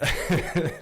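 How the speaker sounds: a short high giggle. Real, not acted. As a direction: burst into a quick giggle. Amused at own side story.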